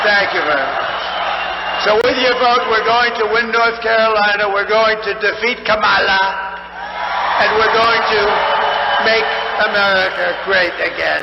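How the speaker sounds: hammering the closing line home to cheering supporters; rally shout. Real, not acted. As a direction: rouse the crowd to a roaring finish. Rally closing call to crowd.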